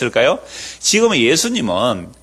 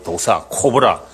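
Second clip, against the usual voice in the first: ordinary talk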